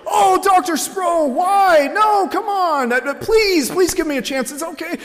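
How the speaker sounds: very high, wailing student plea; hammed-up desperate whine, stretched out; mock begging. Student given a zero pleads with the professor, whiny begging.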